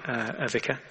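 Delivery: usual speaking voice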